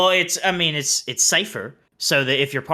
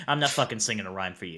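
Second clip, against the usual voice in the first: low blunt retort; 9 semitones lower, 9 dB quieter, faster than usual. Pretend. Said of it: "talk to them like that".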